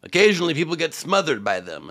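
ordinary delivery

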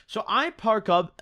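normal talking voice

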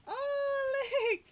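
high loud squeal; excited high exclamation; delighted cry. Real, not acted. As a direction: burst out with joy. Delighted at kittens.

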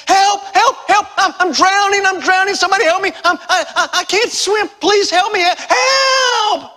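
acted drowning man shrieking for help; high, loud, frantic cries, stammering in terror; panicked screaming. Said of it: "started screaming".

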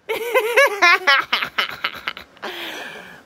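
high, loud burst of happy laughter; joyful laugh. Real, not acted. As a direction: laugh out in pure joy. Store just opened.

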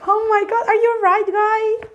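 high puppet duck exclaiming; squeaky duck voice. Pretend. Duck puppet amazed at the students, high squeaky voice. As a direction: talk like a surprised little duck.

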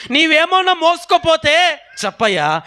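high, insistent begging; urgent pleading. Acted. Mary implores the gardener for the body, pleading.

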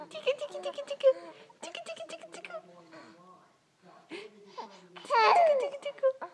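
high sing-song tickle chant; giggly tickle attack. Real, not acted. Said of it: "giggly tickle attack".

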